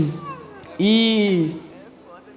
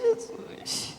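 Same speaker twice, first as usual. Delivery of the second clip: 1 sussurro espantado; 13 dB quieter than usual. Retold or imitated. Espanto sussurrado ao se ver ali.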